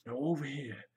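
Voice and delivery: quiet nature-show presenter, hushed; Steve Irwin voice. Pretend. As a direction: narrate it like a wildlife host. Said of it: "drop into Steve Irwin as well and just go".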